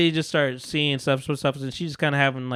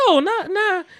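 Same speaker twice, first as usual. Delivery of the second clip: high, whiny panicked no; frantic denial; 14 semitones higher, slower than usual. Retold or imitated. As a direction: deny it fast in a high wail. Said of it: "she's like".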